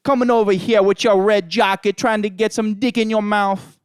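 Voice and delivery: put-on loud, high Jamaican accent taunt. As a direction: jeer in a big Jamaican accent. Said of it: "I always turn into Calypso".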